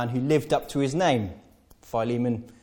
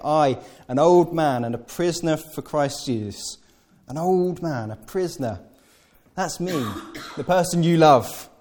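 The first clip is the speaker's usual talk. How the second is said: higher, pitiful self-pity; laying it on; 4 semitones higher, 4 dB louder than usual. Retold or imitated. Paul pleading with Philemon, higher pitiful appeal. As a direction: play for sympathy, pitch up. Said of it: "you can again hear him laying it on".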